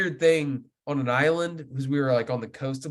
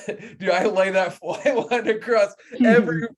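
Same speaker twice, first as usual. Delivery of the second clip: laughing through the words, higher and louder; 7 semitones higher, 4 dB louder than usual. Real, not acted. Telling friends about his bad cast, laughing through it. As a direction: crack up while telling the botched cast.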